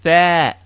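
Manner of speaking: crisp Arabic "Tha" with short open a; teacher modeling "Tha"; model syllable. Shown as a demonstration. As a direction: say "Tha" clearly for students to repeat. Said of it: "Say it with me".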